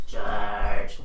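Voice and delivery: lightly voiced gravel-throated smoker; a hint of a rasping chainsmoker; raspy Chainsmoker voice. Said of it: "he sounds like the Chainsmoker from Simpsons".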